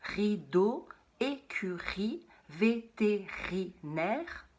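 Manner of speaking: écurie, vétérinaire drawn out slowly; slow syllables. Shown as a demonstration. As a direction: tap out each syllable slowly. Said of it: "On entend à chaque fois riz". (mots scandés lentement, syllabe par syllabe)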